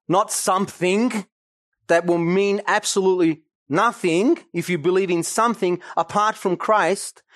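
normal talking voice

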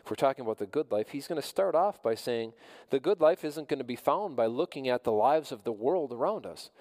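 usual speaking voice

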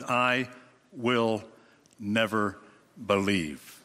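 slow, low, word by word; strong statement. Retold or imitated. Preacher voicing Thomas's vow, slow and weighty. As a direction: space out I will never believe. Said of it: "that strong statement".

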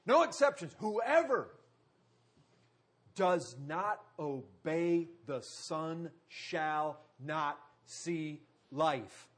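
ordinary delivery

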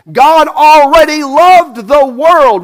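slow, loud and high on "God already loved the world"; preached emphasis. Real, not acted. Preacher to congregation, slow loud emphasis. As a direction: lean hard on "loved". Preacher stressing God's prior love.

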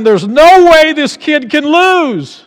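high, loud, slow triumph; triumphant shout. Acted. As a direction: proclaim victory from heaven. Heaven's confident voice.

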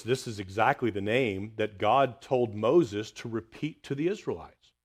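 usual speaking voice

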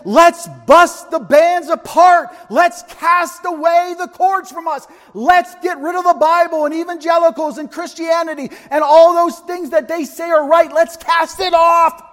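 high mocking shout of the rebels; voicing the raging nations. Preacher voicing the nations plotting, high defiant shout. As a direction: sneer it out like defiant kings. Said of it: "And they're saying".

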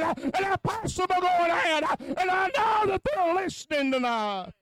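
high, rhythmic preaching with huh breaths; fiery revival preacher; preaching at full force. Acted. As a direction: chant the sermon, gasping huh between phrases.

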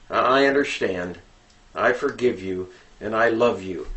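soft, low, gentle reassurance; tender fatherly forgiveness. Pretend. Father forgiving his son Johnny, soft and tender. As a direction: say it quietly, like a loving dad.

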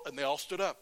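normal talking voice

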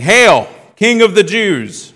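loud, high, drawn-out mock hail; mocking acclamation. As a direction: proclaim it loudly like a jeering soldier. Soldiers mock Jesus in scripture reading.

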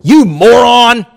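high, loud, slow hammed-up yell; mock outburst. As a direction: blow up at the waitress in jest. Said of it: "I look at her and go".

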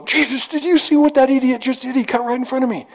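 hammed-up outrage at a bad driver; mock road rage. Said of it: "I talk to Jesus all the time, especially when I'm driving".

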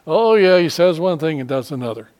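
loud, scornful "Oh, yeah" that settles into a sneer; mocking scoff. Preacher voicing scornful critics of a hypocrite, mocking. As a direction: mimic a cynical listener. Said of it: "And they're not saying".

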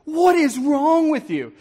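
high, slow, exasperated outburst; acted-out open exasperation at someone; outspoken anger. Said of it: "no longer are we mumbling".

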